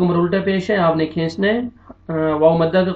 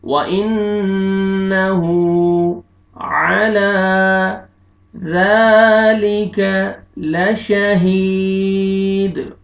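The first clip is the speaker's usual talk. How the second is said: slow melodic recitation, voice lifted; Quran recitation; much slower than usual. Acted. Teacher reciting verses for the class. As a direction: chant the verses slowly and evenly.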